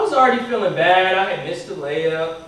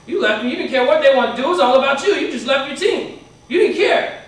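building, loud, higher-pitched reproach; imitating a coach's rising telling-off; accusing scolding; 5 semitones higher, 5 dB louder than usual. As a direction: pile up accusations as an indignant coach. Retold coach scolding the player.

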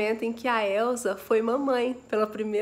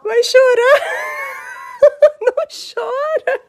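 much higher, loud laughing words; laughing loudly; 13 dB louder than usual. Real, not acted. Mother laughing at daughter about to cry, loud laugh.

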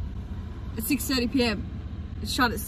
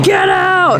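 mock yell; 9 semitones higher than usual. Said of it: "Hardy was vigorously shaking".

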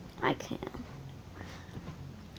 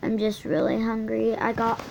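normal talking voice

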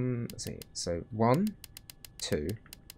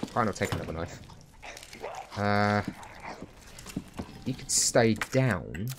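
usual speaking voice

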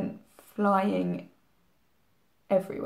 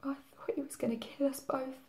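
whisper; 4 semitones higher, 9 dB quieter than usual. Acted. Terrified memory, whispered.

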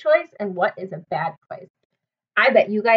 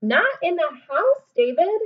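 stern mom; 6 semitones higher, 4 dB louder than usual. Acted. Mom scolding David for ball indoors. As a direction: warn him firmly.

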